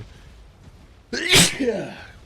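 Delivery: loud sudden sneeze; sneezing. Real, not acted. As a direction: sneeze hard right into the mic. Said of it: "sneeze right into the mic".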